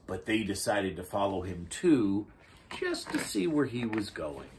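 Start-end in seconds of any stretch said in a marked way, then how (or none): none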